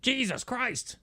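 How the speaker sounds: mock exclamation. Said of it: "Chris said".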